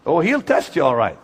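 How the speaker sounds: ordinary delivery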